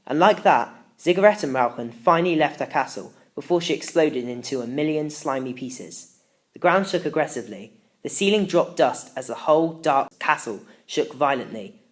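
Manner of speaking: plain reading voice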